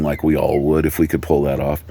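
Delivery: usual speaking voice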